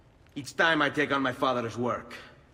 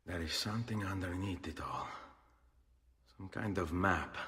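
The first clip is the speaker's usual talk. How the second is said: hushed whisper; 4 semitones lower, 10 dB quieter than usual. Acted. Discovering hidden map on pages.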